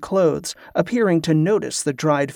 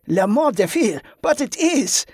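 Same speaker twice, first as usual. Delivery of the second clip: laughing; 8 semitones higher than usual. A narrator's reading. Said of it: "he laughed".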